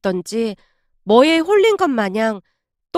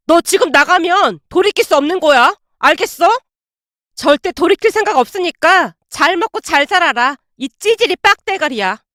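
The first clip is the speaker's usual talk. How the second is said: shouting after her; 4 semitones higher than usual. Acted. Husband shouting after wife walking out, angry yelling. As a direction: yell at her back as she leaves. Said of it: "남편이 뒤에서 소리쳤습니다".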